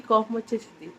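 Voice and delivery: ordinary talk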